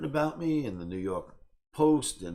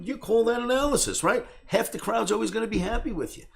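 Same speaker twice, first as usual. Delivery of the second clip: high-pitched, incredulous jab; retold scornful challenge; taunting challenge; 12 semitones higher, 5 dB louder than usual. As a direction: call out a rival, voice pitched up. Retells taunting video challenge to Kramer.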